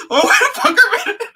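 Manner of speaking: high, rushed, cracking up; laughing imitation. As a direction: act the protest through helpless laughter. Acting victim's protest while cracking up.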